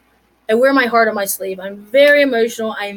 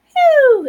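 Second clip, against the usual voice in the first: much higher, louder swipe sound; whoosh noise; 6 dB louder than usual. Retold or imitated. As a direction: make the zoom swipe noise. Mimes swipe to zoom.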